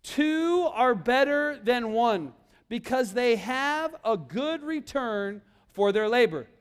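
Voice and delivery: leading the memory verse very slowly; slow, word by word for the children to repeat; slow recitation. Shown as a demonstration. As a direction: say each word slowly so they can follow. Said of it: "So say this with me, this entire thing".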